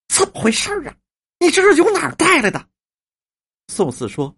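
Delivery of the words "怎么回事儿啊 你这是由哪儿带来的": high-pitched, agitated demand for an answer; stamp-your-foot exasperation; storyteller's flustered, much higher voice; exasperated questioning. A narrator's reading. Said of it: "只在院中跺足啊".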